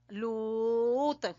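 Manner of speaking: लूत held very long, then stopped at the t; pausing stop. Shown as a demonstration. Teacher demonstrating a pause on लूत, very slow. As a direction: stretch लूत out and stop dead on the t. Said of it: "हमने वक्फ कर लिया लूत".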